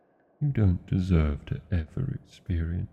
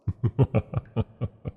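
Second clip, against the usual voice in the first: a dragon's pleased chuckle at the thought; gloating laugh; 4 semitones higher, 4 dB louder than usual. Acted. Dragon gloating over fleeing villagers, pleased laugh. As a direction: laugh with relish at their fear.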